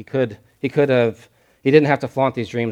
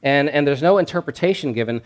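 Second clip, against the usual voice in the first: normal talking voice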